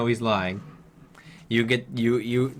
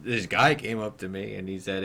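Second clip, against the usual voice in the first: ordinary talk, nothing marked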